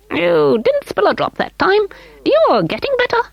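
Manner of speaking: very high, piping call; high little elf calling. A narrator's reading. Mister Munty voiced.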